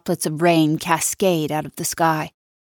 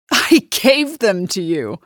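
mocking disbelief; 2 semitones higher, 4 dB louder, slower than usual. [name] mocking Millie's claim.

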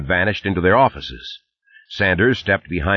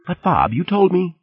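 pleading; 12 semitones higher than usual. Acted. Blackburn protests, cut off.

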